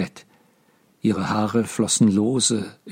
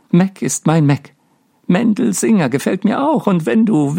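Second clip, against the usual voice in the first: manic madwoman; 7 semitones higher than usual. Acted. Miriam confessing to her father from the sickbed, manic and giddy. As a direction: babble in a wild, unhinged rush of glee. Delirious daughter rambles at father.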